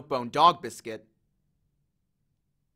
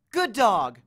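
higher, louder praise; dog-trainer praise; 5 semitones higher, 5 dB louder than usual. A narrator's reading. Annabeth praises Cerberus for obeying, firm. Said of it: "the same tone she was using on the dog".

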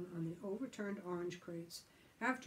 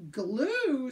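loud, high, drawn-out outburst; outraged scream; 5 semitones higher, 10 dB louder than usual. A narrator's reading. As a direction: scream it in sudden disbelief. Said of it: "screamed Billy all of a sudden".

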